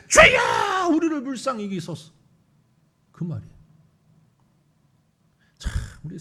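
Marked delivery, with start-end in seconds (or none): 0.1-2.1 desperate shout. Acted. Blind men shouting over crowd.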